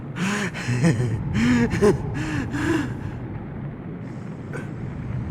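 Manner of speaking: long, drawn-out eerie villain laugh. Acted. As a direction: laugh slowly, savouring the other's pain. Mantis laughs at shared trauma.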